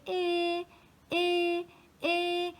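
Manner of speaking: light high cooing eh eh eh; smiling baby's coo. Retold or imitated. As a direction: imitate a happy baby babbling. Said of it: "Elle faisait".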